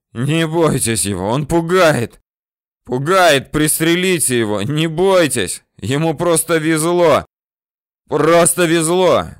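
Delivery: high, loud yell with last strength; desperate yelling. A narrator's reading. Bandit chief urges his men to shoot him, yelling desperately. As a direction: yell with everything you have left. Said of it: "орёт из последних сил главный бандит".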